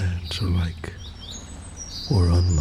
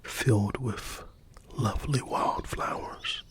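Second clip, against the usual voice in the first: soft unvoiced storytelling whisper; ASMR bedtime story whisper; whispered narration; 2 semitones higher, 6 dB quieter than usual. A narrator's reading. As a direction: breathe the story out gently.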